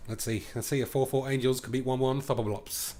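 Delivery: ordinary delivery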